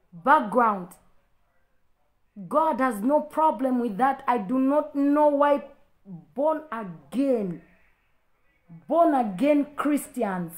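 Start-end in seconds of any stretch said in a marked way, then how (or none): 4.3-10.5 slow, emphatic scolding. Real, not acted. Preacher scolding prejudiced Christians.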